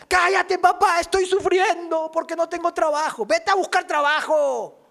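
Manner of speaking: high, loud mock retort from a spoiled son; a son yelling back at his father in a high, bratty, mocking voice.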